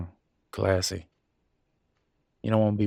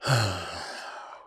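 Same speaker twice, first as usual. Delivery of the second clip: long breathy sigh; weary sigh; 2 semitones lower, 4 dB quieter than usual. Acted. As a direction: sigh out heavily. Weary pause in marital argument.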